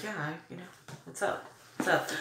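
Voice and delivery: acting cool and unbothered; playing it chill. Casual greeting, wanting to seem chill to the star. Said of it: "you wanna play it chill".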